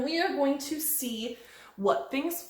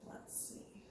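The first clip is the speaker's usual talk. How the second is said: hushed suspense whisper; breathy suspenseful whisper; 22 dB quieter than usual. Acted. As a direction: whisper it with anticipation. Whispering before the test.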